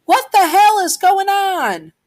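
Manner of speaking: very high, loud, drawn-out shriek; shrieking mother breaking up a fight; mother's scream. A narrator's reading. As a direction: scream it slowly in outraged alarm. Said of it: "you hear your mother scream".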